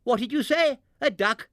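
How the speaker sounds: high, loud startled cry; cried out. A narrator's reading. Barnaby's mother shouting over the commotion, high startled cry. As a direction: call out in confusion. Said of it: "cried Barnaby's mother".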